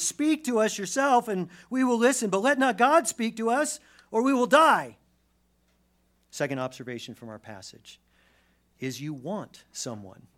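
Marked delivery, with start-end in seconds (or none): 0.0-5.0 high, loud, alarmed appeal; urgent pleading. Acted. Israelites begging Moses to speak instead of God, urgent pleading. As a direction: cry it out as a desperate acted plea.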